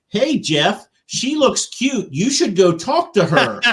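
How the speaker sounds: loud, high, drawn-out teasing call; shouting across. Retold or imitated. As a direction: embarrass the nephew from across the room. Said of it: "shouting it across the".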